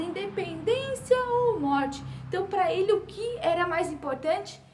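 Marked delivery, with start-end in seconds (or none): 0.0-2.0 retold battle cry. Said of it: "ele gritou essa frase".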